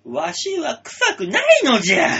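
a wise elder protesting through giggles; creaky wise old man. Pretend. わし/のじゃ old man role.

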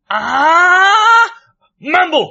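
long rising yell, then the word; loud, high comic outburst; shouted gag. Acted. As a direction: belt out a drawn-out goofy shout. Shouted gag as his impression.

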